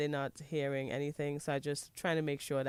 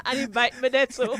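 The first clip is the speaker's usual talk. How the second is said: loud, high words through laughter; real laughter; laughing; 7 semitones higher, 11 dB louder than usual. Real, not acted. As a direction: blurt it out while laughing. Laughing, adds to the joke.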